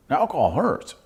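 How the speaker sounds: usual speaking voice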